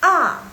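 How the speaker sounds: loud A with a steep fall; A starting high and falling fast; fourth tone. Shown as a demonstration. Teacher drilling the fourth tone, sharp falling A. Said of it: "Yang keempat".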